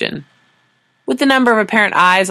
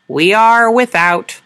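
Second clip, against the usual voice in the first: slow, louder alien pronouncement; alien translator voice; 6 semitones higher, 5 dB louder, much slower than usual. Acted. Translator device answering Sora, slow and louder. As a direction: intone it like a machine oracle.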